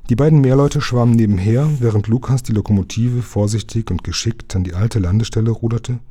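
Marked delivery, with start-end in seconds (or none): none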